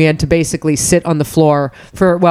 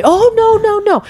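mock falsetto plea; slower than usual. Mock pleading landlord reply.

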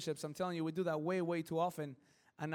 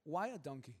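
quiet question; 4 semitones lower, 6 dB quieter than usual. Shown as a demonstration. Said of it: "let me ask you one thing".

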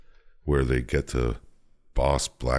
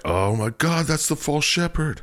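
mock alarmed cop; 12 semitones higher than usual. Cops recognizing the branded hand.

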